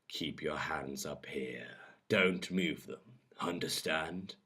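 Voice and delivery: soft, slow, controlled orders; hushed, deliberate dominance; quiet command. A narrator's reading. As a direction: instruct slowly in a hushed voice. Dominant lover giving quiet orders.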